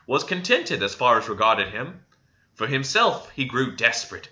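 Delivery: plain reading voice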